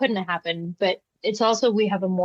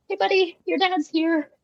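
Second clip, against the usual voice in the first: put-on high bright call to a little kid; sing-song to a toddler; 10 semitones higher than usual. Caregiver tells a toddler his dad is here, high sing-song. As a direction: announce dad like a cheerful caregiver. Caregiver calling child to door.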